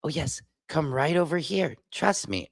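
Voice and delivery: soft, sly coaxing voice; quiet, oily mock reassurance; mocking news anchor. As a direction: lure them in like a smooth salesman. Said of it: "It's like".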